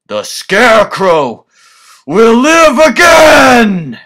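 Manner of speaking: maniacal high triumphant shout; scarecrow. Acted. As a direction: scream it out like a madman. Villain's climactic triumphant declaration.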